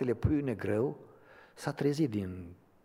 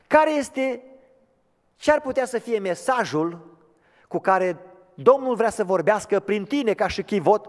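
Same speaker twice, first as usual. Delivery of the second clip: much higher and louder, pleading sermon voice; impassioned preaching; 10 semitones higher, 12 dB louder than usual. Acted. Preacher asking the church what its message is, impassioned preaching. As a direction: call out to every hurting soul.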